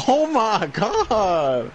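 high, long drawn-out reaction; amazed exclamation. Real, not acted. Reacting to gameplay.

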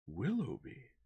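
surprised cry. A narrator's reading. Sir John hears the name he knows, surprised cry. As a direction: blurt the name out, startled. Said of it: "cried Sir John".

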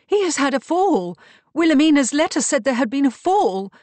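high, loud, agitated insistence; insistent. A narrator's reading. Beatrice insists on what the letter said, loudly insistent.

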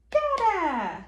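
put-on playful drawn-out baby word; exclaimed refrain. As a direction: call it out in singsong. Parent cheers book's refrain to child.

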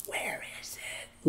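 slow, breathless whisper to himself; a slow hushed whisper, barely voiced; whispered inner thought. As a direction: whisper it as if searching alone in the dark. Frantic search for lost drugs.

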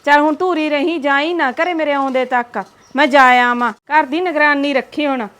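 loud call across the yard; calling out loudly. Acted. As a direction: shout your instructions to the neighbour. Calling out to neighbour across house.

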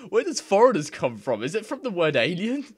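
high, loud puzzled question; baffled asking; incredulous. Real, not acted.

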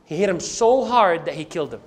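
usual speaking voice

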